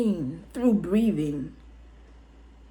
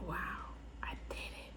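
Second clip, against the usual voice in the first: hushed, breathless whisper; awed whisper; 22 dB quieter, much faster than usual. Retold or imitated. New mother whispers in wonder after giving birth. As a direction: barely voice it, stunned. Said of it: "And I was like".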